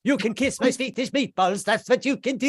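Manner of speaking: hammed-up offended Swedish; offended Swede. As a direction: bristle like an insulted Swede. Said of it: "you might be insulting them".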